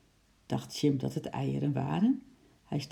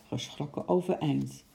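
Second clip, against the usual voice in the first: plain reading voice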